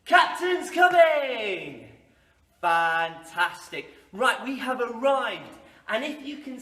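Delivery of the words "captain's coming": slow, loud, high shout. Acted. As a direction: call it across the hall. Game call to class.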